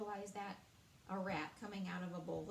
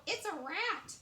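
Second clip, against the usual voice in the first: yelling; 9 semitones higher, 4 dB louder than usual. Acted. King keeps yelling.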